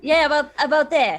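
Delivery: loud, hammy New York accent; bad New York accent. Shown as a demonstration. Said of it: "a little less Australian than that".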